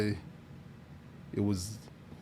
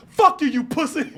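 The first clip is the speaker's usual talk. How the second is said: loud, high-pitched mock rage; hammed-up furious yell; mad; 14 semitones higher, 9 dB louder than usual. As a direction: shout the insult as the angry caller. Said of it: "Guy got mad".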